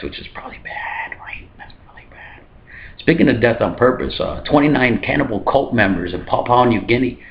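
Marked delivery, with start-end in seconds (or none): 0.0-2.4 whispered aside, fading away; hushed aside. Acted. Comedian admitting the cashed checks look bad, whispered aside. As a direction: drop to a whisper, as if to yourself. Muttered aside on his own joke.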